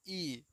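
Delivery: soft, held ई; long vowel. Shown as a demonstration. Teacher repeats the big vowel for pupils, long ई. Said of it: "बड़ी को क्या पढ़ेंगे".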